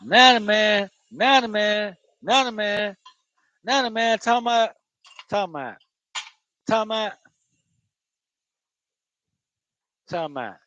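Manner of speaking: high, slurred Florida "talking about"; Florida accent. Shown as a demonstration. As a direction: run it together in a high Florida twang. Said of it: "trust me, they come from Florida".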